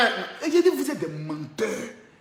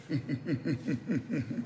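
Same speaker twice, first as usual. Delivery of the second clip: low, soft, pulsing laugh; low chuckle; 11 semitones lower, 13 dB quieter than usual. Acted. After warning the rival, a low drawn-out chuckle. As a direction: chuckle slowly and quietly down low.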